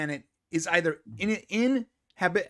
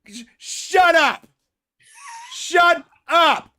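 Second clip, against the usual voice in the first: yelling shut up; 8 semitones higher, 5 dB louder, much slower than usual. Real, not acted. Yelling at the video.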